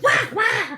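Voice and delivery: high mock babble "Wah, wah"; babbling wah wah. Mocking a friend's jazz rant as babble, high. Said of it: "all you hear is like".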